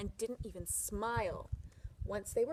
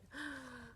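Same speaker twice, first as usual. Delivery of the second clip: delighted gasp; 4 semitones higher, 9 dB quieter than usual. Acted. Wife sees strawberries.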